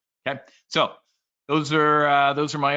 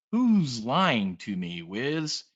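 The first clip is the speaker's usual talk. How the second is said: ordinary talk, nothing marked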